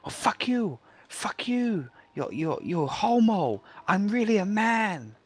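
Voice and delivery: high, loud, slow mock rant, far from own voice; mock angry scammer. Scammer drops the act and curses the grandfather, high and slow. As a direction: spit out the insults like an unmasked scammer. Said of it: "Like that".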